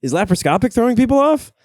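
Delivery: high, loud, fast mock disbelief; incredulous outburst. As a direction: sputter in loud amazement at their ignorance. Acted disbelief at moviegoers.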